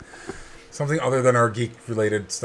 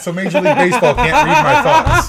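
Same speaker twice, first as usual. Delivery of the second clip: high, loud, laughing delivery; pitched way up through laughter; 10 semitones higher, 5 dB louder than usual. Real, not acted. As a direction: crack up while getting the joke out. Joking through the group's laughter.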